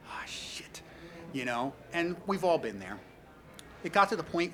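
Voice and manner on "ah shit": whispered curse; under the breath. Retold or imitated. Dreading another call, whispered curse. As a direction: mutter it so nobody hears.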